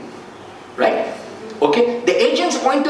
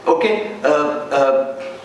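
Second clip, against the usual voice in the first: ordinary talk, nothing marked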